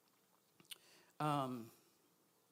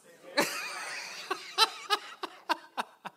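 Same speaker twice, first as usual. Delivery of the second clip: breathy laugh; 11 semitones higher, 4 dB louder than usual. Real, not acted. Laughing after congregation repeats.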